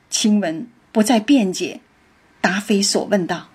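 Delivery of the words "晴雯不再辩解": ordinary delivery, nothing marked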